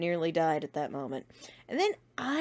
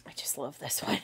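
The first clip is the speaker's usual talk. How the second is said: whisper; 5 semitones lower, 7 dB quieter than usual. Real, not acted. Admiring fan art.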